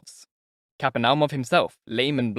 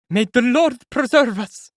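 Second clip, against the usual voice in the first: high, loud and slow plea; a frightened woman calling on God; pious woman; 12 semitones higher, 8 dB louder, slower than usual. A narrator's reading. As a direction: onlooker woman praying aloud, high and slow. Woman in crowd crossing herself.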